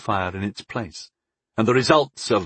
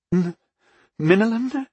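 hesitant, high rising question; uneasy goddess, high-pitched; shaking voice; 10 semitones higher than usual. A narrator's reading. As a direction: falter on the name, voice pitched up. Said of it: "asked in a shaking voice".

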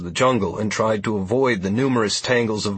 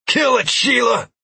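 shouting; 4 dB louder than usual. A narrator's reading. Command during the charge.

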